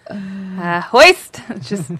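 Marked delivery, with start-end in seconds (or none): 0.8-1.4 loud high cry of hoist; comic shout. Acted. Joke shout after lock her up.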